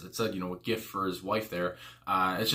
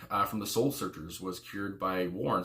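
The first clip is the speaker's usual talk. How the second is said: usual speaking voice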